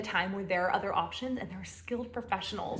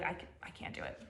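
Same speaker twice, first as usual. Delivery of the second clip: broken whisper; 6 semitones lower, 18 dB quieter than usual. Retold or imitated. Retold breaking point.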